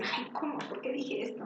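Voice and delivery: whispered frustration under breath; frustrated whisper. Shown as a demonstration. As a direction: mutter it to yourself, annoyed at the slip. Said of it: "por eso dicen".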